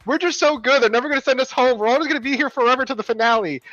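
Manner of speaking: cocky contestants. Retold or imitated. Mocking queens who think they're safe, high and smug. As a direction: brag like you can't lose. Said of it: "they were like".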